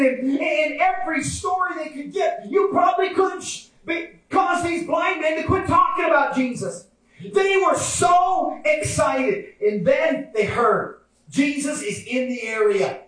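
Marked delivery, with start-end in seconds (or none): 0.0-9.5 high, impassioned sermon voice; fervent preaching. Real, not acted. Preacher describing excited blind men, high fervent preaching. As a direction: preach it with rising fervour.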